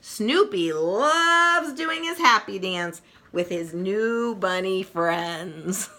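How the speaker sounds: usual speaking voice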